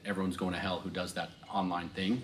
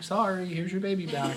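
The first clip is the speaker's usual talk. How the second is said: much higher put-on apology, lightly voiced; mock nursery worker; 11 semitones higher than usual. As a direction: hand the baby back in a mock apologetic voice. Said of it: "nursery worker being like".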